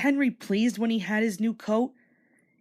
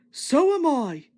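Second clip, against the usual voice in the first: eager engine; 5 semitones higher, 4 dB louder, slower than usual. Acted. Edward eager.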